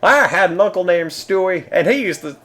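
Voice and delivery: high impression slipping into country bumpkin; Bill Cosby. Streamer retrying a celebrity impression for viewers, drifting into high country bumpkin. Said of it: "now it's turning into, like, country bumpkin".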